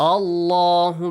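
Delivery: chanted "Allahu" with a full, dark double L; heavy Lam. Shown as a demonstration. Teacher models the word Allah, heavy recited Lam.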